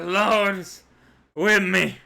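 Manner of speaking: strained, loud dying words; dying gasp. Acted. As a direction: force it out through blood. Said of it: "He, spitting up blood, he said".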